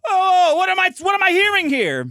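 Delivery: high, loud Yiddish exclamation; shouted, exaggerated Yiddish accent. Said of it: "and he goes".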